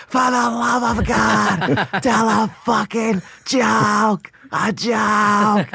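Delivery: put-on high, slow drunken heckler shout; hammed-up heckler yelling at a bombing comic; loud heckler. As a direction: bellow it from a back table at the comic. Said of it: "he screamed that for four minutes".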